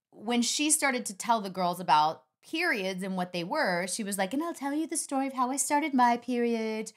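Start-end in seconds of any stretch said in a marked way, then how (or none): 4.3-6.9 put-on higher voice, slowing for the dramatic phrase; high-pitched teacher voice. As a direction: mimic an uncomfortable teacher telling her story. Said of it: "always sort of talked like this".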